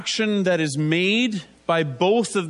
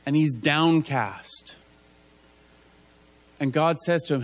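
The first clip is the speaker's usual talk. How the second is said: usual speaking voice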